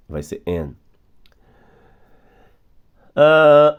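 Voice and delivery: normal talking voice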